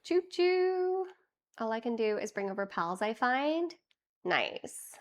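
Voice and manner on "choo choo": high sing-song conductor call; chugging toy train voice. Pretend. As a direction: call all aboard like a cheery conductor. Streamer train hype call.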